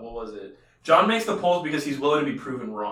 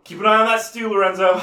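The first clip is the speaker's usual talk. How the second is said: mock boss; 6 semitones higher, 8 dB louder than usual. Imagining John cooking.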